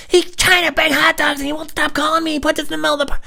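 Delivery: very high, loud protest; frantic yelling. Retold or imitated. Prank victim explains hot dogs to driver, frantic high yelling. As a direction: plead your case in a squeezed yell.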